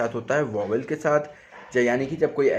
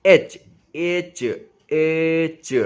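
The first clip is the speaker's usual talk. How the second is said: एच, एच drawn out very slowly and louder; slowed-down sounding; 3 semitones higher, 5 dB louder, much slower than usual. Shown as a demonstration. Teacher isolating the first sound of HDFC. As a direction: stretch each एच to hear its sound. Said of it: "यहाँ पर ध्यान दीजिएगा".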